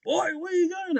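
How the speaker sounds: high-pitched, puzzled dad call; calling after someone. Retold or imitated. Dad to son running past him, calling out.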